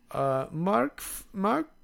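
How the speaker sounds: put-on slow, tentative German-accented guess, rising high at the end; hesitant, questioning mock German accent. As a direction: imitate a German contestant guessing unsurely. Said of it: "like a German accent".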